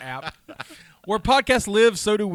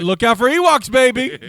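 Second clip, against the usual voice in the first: loud, raised-pitch holler; hammed-up yell to someone passing; mock warning shout; 9 semitones higher, 14 dB louder than usual. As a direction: call it out big and playful. Imagined shout at passing biker.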